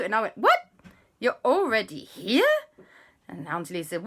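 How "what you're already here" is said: very high, loud, rising what; astonished. Acted. Hearing the relatives are already here, astonished. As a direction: squeal in disbelief. Narrator acts astonishment at arrival.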